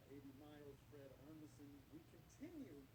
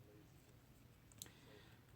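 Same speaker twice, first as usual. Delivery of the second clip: whisper; 7 semitones lower, 5 dB quieter, slower than usual. Real, not acted. Host whispers aside.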